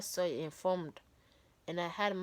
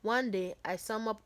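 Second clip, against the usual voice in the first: ordinary delivery, nothing marked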